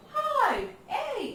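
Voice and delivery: "हाइट" and "ए" stretched on a high, falling pitch; exaggerated sound check. Shown as a demonstration. Teacher asking if it sounds like that, exaggerated high demonstration. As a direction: test "हाइट" against a long "ए" sound. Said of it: "ऐसा हो रहा है क्या?".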